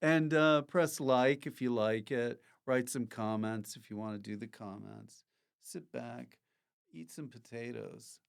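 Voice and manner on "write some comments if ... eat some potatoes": very soft, low murmur; hushed sign-off. Real, not acted. Host winding down the show to viewers, in a hushed murmur. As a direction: sink down to a quiet, low close.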